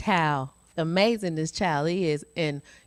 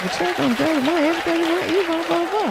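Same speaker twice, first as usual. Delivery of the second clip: high, louder excited chant; 13 semitones higher, 3 dB louder, faster than usual. Retold or imitated. Mother thrilled about the monthly check, high excited chant. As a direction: squeal it way up high in mock excitement. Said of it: "I got excited. I was like".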